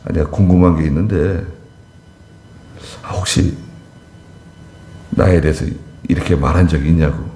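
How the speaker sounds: calm asking. Shown as a demonstration. Preacher modelling a friendly question to the gossiper, calm and low. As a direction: ask without a trace of anger. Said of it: "화내지 말고".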